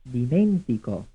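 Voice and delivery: ordinary delivery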